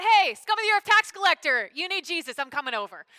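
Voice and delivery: loud, high, sneering put-down; a preachy looking-down-on-him call; mock condescension.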